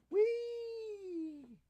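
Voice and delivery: very high, gleeful squeal; playful whee. Real, not acted. Owner playfully lifting his cat away with a high gleeful squeal. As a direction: squeal it like a ride.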